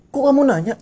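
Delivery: high, loud mock snap; mock officer snap. Officer snaps at the stopped rider, high mock bark. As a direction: snap back like a bossy officer. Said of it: "Terus dia langsung bilang".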